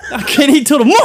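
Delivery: high, loud comic wail; mock despair. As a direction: lament a lost meal, half laughing. Despair over the dropped apple.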